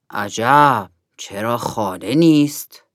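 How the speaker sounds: low-pitched eagle voice, wondering; puzzled eagle. A narrator's reading. Golden eagle wondering why the host is away, low and puzzled. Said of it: "عقاب طلایی گفت".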